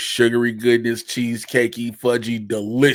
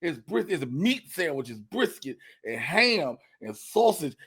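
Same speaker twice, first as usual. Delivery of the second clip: ordinary delivery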